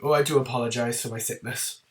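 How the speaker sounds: ordinary delivery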